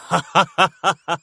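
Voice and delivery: high, hysterical cackle; hysterical laugh. A narrator's reading. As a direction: burst into mad laughter. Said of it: "Забился в истерическом смехе". (истерический смех)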